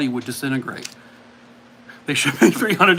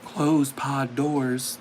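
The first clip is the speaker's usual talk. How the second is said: slow quote; 3 semitones lower, 3 dB quieter, much slower than usual. Acted. Repeating movie quote clue slowly.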